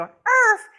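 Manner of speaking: high loud yap; sharp imitated yap; dog bark. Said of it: "every time they go".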